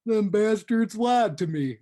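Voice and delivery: put-on high, loud, slow old man grumble. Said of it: "one of his marquee lines is".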